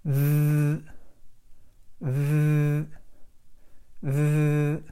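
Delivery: th buzzed with the voice on, tongue touching the top teeth; voiced th demo. Shown as a demonstration. Teacher demonstrates the voiced th to students, buzzing. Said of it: "So I go".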